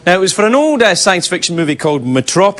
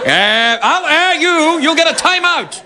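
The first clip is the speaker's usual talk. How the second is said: high-pitched hammed-up telling-off; mock scolding parent; 10 semitones higher than usual. Director telling off his kid for pointing a finger, high mock scolding. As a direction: scold a child in a raised pitch.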